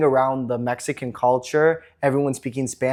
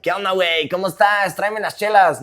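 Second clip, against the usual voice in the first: higher-pitched mock Mexican slang voice; caricatured Mexican accent; 4 semitones higher than usual. Shown as a demonstration. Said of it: "we all don't talk like".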